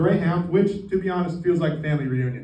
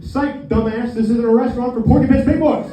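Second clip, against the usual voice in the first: high, loud imitated taunt, building to a shout; mock waiter's jeering yell; 6 semitones higher, 8 dB louder than usual. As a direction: jeer as the waiter, getting louder to the end. Waiter's mocking rejection, building louder.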